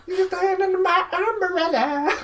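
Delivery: high squeaky witch singing; creepy witch. Pretend. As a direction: squeak it like a chipmunk. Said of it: "You sound like a creepy witch".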